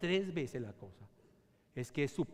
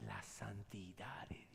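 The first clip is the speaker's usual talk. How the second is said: slow whispered reveal; a hushed, slow whisper; 6 semitones lower, 11 dB quieter, slower than usual. Acted. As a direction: whisper it with awe. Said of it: "whispered answer".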